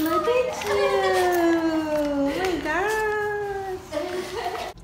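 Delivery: high, loud, very slow and stretched; drawn-out loud squeal of delight; delighted gushing. Real, not acted. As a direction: coo at your dog, overjoyed. Reunion with the groomed dog.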